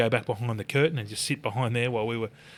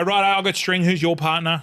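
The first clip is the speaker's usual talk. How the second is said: loud and higher, rallying Russell; calling out; 5 semitones higher, 5 dB louder than usual. Retold or imitated. Calling Russ over the blaring music, loud and higher. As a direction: call it out over the DJ.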